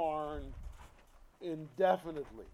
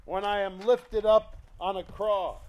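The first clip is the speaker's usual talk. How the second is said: loud, driving sermon voice; preacher pounding the message; preaching; 2 semitones higher, 6 dB louder than usual. Acted. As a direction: press the point home. Preaching at full force.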